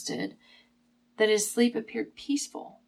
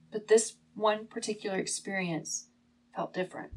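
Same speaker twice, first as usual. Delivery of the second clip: ordinary talk, nothing marked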